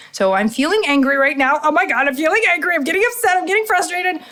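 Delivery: hammed-up frustration spiralling; mock agitated. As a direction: act out getting worked up, climbing in pitch. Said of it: "My old self would have that old reaction".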